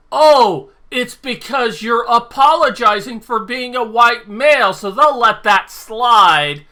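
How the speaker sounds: bitterly sarcastic, voice pushed up; sarcastic outrage. Real, not acted. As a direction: scoff loudly as if it all makes sense now. Sarcastic answer to own question.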